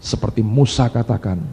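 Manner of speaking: normal talking voice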